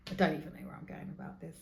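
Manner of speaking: normal talking voice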